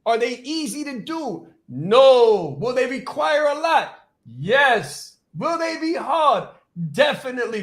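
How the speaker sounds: high-pitched questions, punched answers; emphatic call-and-answer. Real, not acted. Coach warning how hard programs are, emphatic. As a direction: fire off rhetorical questions and answer them hard.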